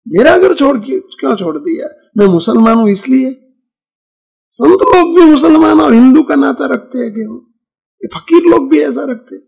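imitated old woman. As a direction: lightly voice an old woman protesting in Hindi. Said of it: "ડોશી હિન્દી ભાષી હતા".

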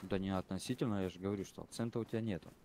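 usual speaking voice

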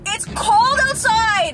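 drawn-out, high mock whine; whiny protest. Caller refusing to get out of car, put-on whining. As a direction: drag it out in put-on complaint.

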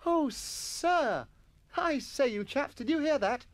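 very high, gleeful schoolboy; delighted exclamation. A narrator's reading. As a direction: marvel in delight to your chums. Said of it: "exclaimed Jennings in delight".

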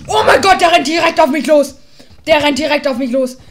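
loud, high panicked yells; real gamer panic scream; panicked shouting. Real, not acted. Attacked by charging mob.

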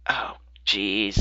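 unvoiced hushed groan; whispered dread. Real, not acted. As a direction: whisper it reluctantly. Facing a skeleton.

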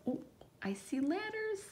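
high, hushed wonder; playful surprised discovery; mock amazement. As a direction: marvel at it for the kids. Teacher showing amazement to children.

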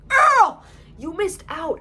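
high, loud shriek of disbelief. Real, not acted. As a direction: scream the word at her. Reacting to the story.

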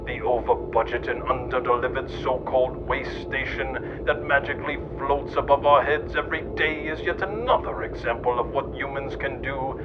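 normal talking voice

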